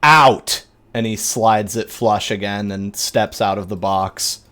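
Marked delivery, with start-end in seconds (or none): 0.0-0.6 loud, raised command, each word set apart; priest ordering out. Acted. Priest orders penitent out of the confessional, forceful shout. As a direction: throw them out with a forceful order. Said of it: "he says".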